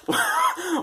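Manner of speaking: sudden squeaky laugh; high burst of laughter. Real, not acted. Laughing at the [name] lyric, a high giggle. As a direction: crack up at the lyric.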